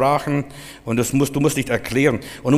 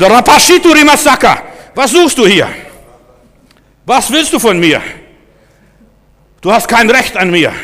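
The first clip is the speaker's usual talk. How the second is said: high, shouted challenge; fly up and shout the intruder down; flaring-up rebuke; 9 semitones higher, 9 dB louder than usual. Shown as a demonstration. Preacher demonstrating how to confront spirits, furious high shout. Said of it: "Und dann fährst du hoch".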